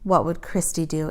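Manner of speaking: ordinary delivery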